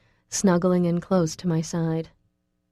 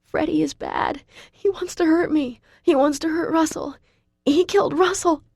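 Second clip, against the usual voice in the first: much higher, thin, breathless and frantic; thin breathless panic; 10 semitones higher than usual. A narrator's reading. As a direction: warn him desperately, gasping for air. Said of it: "so thin and breathless".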